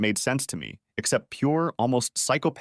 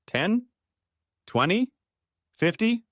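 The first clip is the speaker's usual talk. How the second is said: each guess pitched much higher, incredulous; scornful questioning; 11 semitones higher, slower than usual. Acted. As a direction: fire the numbers at her in disbelief. Furious husband interrogating wife.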